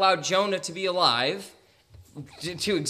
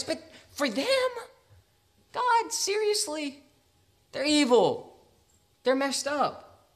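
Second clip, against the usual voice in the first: whiny complaint; 11 semitones higher, slower than usual. Retold or imitated. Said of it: "wanted his cake and eat it".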